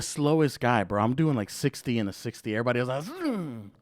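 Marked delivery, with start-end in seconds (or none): 3.0-3.7 soft, high whoosh of a passing car; imitated zoom. Driver showing how everyone else passes him, zooming noise.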